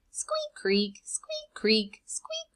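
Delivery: squeaks very high, creaks whispered; squeaky wagon wheels. Acted. Reader imitating the rusty wagon, squeaks high and creaks whispered.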